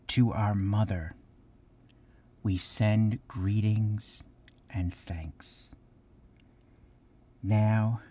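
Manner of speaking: measured prayer-like reading with long pauses between phrases; solemn recitation. Real, not acted. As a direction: recite a thanksgiving address reverently, letting each phrase settle. Recites the thanksgiving address.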